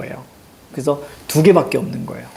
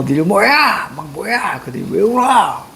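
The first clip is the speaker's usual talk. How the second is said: raised-pitch, scolding questions; mock angry instructor; 4 semitones higher than usual. Drill instructor storms in on crying recruits, mock angry. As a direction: burst in demanding answers, acted anger. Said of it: "육두문자를 날리면서".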